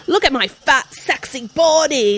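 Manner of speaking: high, loud, drawn-out mock seductive voice; mocking sexy-joke voice. Parodying a comic actress's jokey sexy scenes, high and hammed-up. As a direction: ham up the self-mocking sexy bit. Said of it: "like, a".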